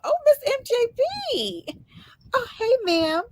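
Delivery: high, sung-out hello; light up to greet her; delighted greeting. Real, not acted. Host welcoming a friend onto the panel, delighted. Greeting a guest joining live.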